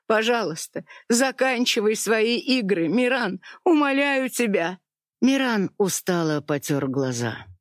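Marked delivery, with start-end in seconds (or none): 0.1-4.8 higher, rising desperate begging; pleading. A narrator's reading. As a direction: beg him, voice climbing. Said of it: "умоляла Генюль". (отчаянная мольба)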